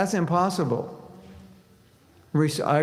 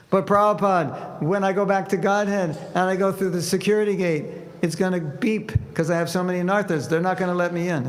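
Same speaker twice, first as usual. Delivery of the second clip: higher, worried disciple's voice; fretting that the gate will beep; anxious devotee; 5 semitones higher than usual. Acted. Devotee pleading with Prabhupada, higher and worried.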